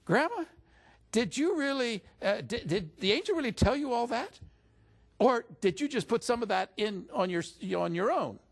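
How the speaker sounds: high, loud, incredulous blurt at grandma; blurted-out challenge. Retold or imitated. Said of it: "I finally blurted out and said".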